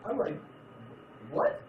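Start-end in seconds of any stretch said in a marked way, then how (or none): 1.3-1.6 shrill disbelieving what; high-pitched shocked what; stunned what. Retold or imitated. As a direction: squeak it out in total shock. Shocked at friend's news.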